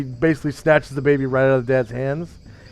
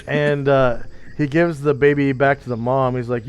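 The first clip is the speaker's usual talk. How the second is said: usual speaking voice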